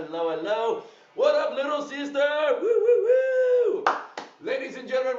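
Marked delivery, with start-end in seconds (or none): 1.1-3.8 excited whooping. Real, not acted. Host greets his little sister on stream, excited whooping.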